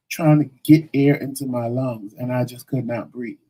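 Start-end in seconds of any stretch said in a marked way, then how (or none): 0.1-2.1 a sharp gasp before the words; gasping for air. Retold or imitated. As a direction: gasp as if you can't get air in. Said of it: "I couldn't breathe".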